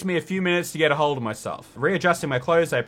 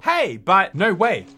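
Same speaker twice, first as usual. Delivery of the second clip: ordinary delivery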